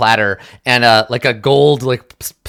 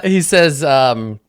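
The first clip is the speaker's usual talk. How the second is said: ordinary delivery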